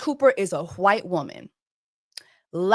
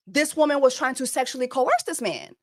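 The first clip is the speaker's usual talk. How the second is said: ordinary delivery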